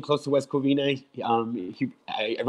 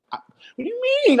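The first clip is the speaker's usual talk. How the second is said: high, fast, disbelieving; incredulous outburst; much faster than usual. Retold or imitated. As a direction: shoot it out in sudden disbelief. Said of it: "I'm like".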